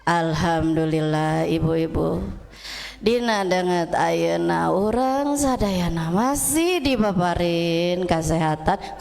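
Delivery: low, dragging monotone speaker; sleep-inducing lecture drone; flat, slow preacher. Acted. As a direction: imitate a droning ustad, far too slow. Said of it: "dadana itu datar".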